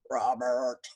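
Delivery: low booming monster call; monster teacher. Acted. Monster teacher calls the boy, low and booming. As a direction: growl the name like a monster teacher.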